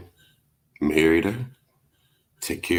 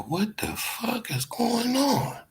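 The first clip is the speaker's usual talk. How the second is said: much higher, slow, softer disbelief; stunned question; 7 dB quieter, slower than usual. Retold or imitated. Seeing three girlfriends at his bedside, stunned high slow question. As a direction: drag it out, pitched up in shock. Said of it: "I said".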